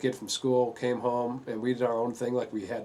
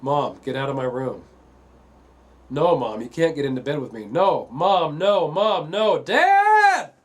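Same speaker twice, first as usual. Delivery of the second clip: building from firm loud to a high shrieking scream; retold scream; 4 semitones higher, 10 dB louder, slower than usual. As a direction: start loud and climb until the voice cracks upward in panic. Son screaming mother out of bed.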